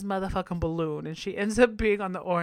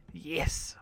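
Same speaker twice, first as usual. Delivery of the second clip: whispered yes; 4 semitones lower, 7 dB quieter than usual. Real, not acted. Aroused aside about Bruno.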